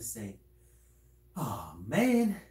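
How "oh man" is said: drawn-out, swooping, louder lament; regretful groan. Acted. Imagining a person too late to apologize, loudly regretful. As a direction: wail it in mock regret, pitch dipping then soaring. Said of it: "You're gonna regret it".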